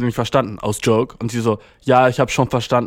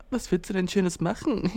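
mock sweet date question; 4 semitones higher, 8 dB quieter than usual. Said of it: "Ich hasse".

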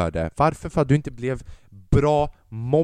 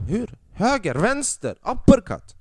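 coaching yell; 10 semitones higher, 3 dB louder, slower than usual. Retold or imitated. Father coaching son to fight.

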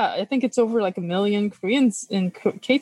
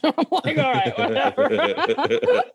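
laughing; 4 semitones higher, slower than usual. Real, not acted. Mom laughs off kid's rap.

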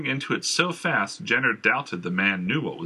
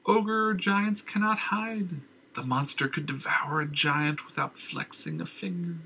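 raving madman; 7 semitones higher, slower than usual. Acted. Madman scorns the idea.

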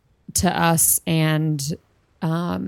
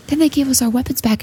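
ordinary talk

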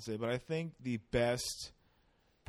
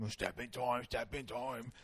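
soft, lightly put-on Cockney accent; 3 semitones lower, 6 dB quieter than usual. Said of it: "I end up sounding just like".

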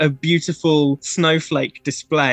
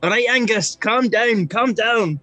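higher Scottish voice calming a pet; Scottish kid soothing his puffin; 6 semitones higher than usual. Acted. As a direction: settle the bird down, lad. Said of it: "he said".

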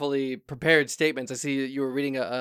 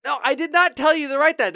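indignant; 8 semitones higher, 6 dB louder than usual. Acted. Indignant denial.

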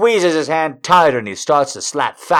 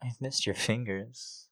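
much softer, breathy murmur; soft whisper; 17 dB quieter than usual. A narrator's reading. Narrator confides to him, soft murmur. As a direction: breathe it out quietly.

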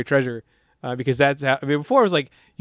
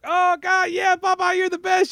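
mock adoring crowd, much higher; crowd cheering; slower than usual. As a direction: gush like a fawning crowd. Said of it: "And everyone's like".

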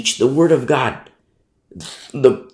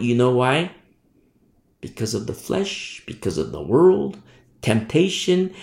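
ordinary delivery

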